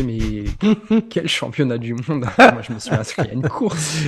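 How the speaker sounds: retelling his own surprised reply with a laugh; baffled. Retold or imitated. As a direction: play it baffled and amused at once.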